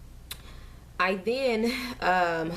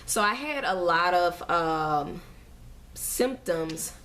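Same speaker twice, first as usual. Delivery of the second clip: ordinary delivery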